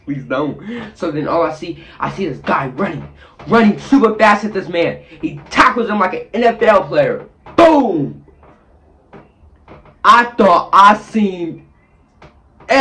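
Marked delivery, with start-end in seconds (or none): 0.0-0.6 mock scared pleading. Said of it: "I'm screaming at this point. I'm scared". 7.6-8.2 loud, high boom; boom impact sound. Retold or imitated. Describing the tackle, loud boom.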